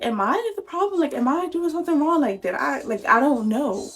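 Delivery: high, worried questioning; doubtful self-questioning. Retold or imitated. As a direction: ask yourself anxiously if you're the problem. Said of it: "So I'm like".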